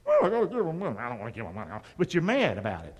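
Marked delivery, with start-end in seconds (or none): 0.1-1.7 low, sulky grumble; mad giver. Retold or imitated. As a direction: grumble like you're forced to pay. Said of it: "That's the gun to the head giver".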